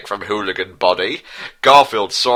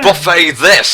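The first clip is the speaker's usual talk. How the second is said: higher and louder shout; 8 semitones higher, 7 dB louder than usual. Acted. Hooligan shouts at Garfield before the bottle attack, higher and louder. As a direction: yell it as a bar-brawl challenge. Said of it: "shouted one hooligan".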